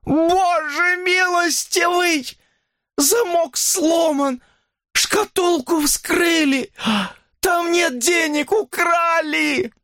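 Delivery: very high, drawn-out wail; woman shrieking at a disaster; horrified scream. A narrator's reading. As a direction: cry out in horror, stretching every word. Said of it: "в ужасе закричала".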